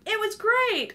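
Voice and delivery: high, airy offhand compliment; breezy mock praise. Friends brushing off a dancer's question, high breezy praise. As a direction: toss it off like an empty compliment. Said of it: "they'd be like".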